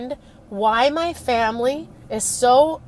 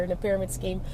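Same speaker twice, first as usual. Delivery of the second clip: joking repeat, much softer; 4 semitones lower, 10 dB quieter, much faster than usual. Retold or imitated. Jokingly repeating it to the school counselor, much softer. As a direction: tease it back under the breath. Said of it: "I kinda jokingly said to her".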